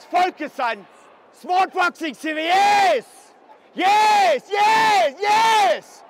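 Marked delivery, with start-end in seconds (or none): 2.4-6.0 loud, high, drawn-out cheers; excited cornerman yelling; roaring approval. Real, not acted. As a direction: shout each one like a punch landed. Coach cheering good work in fight.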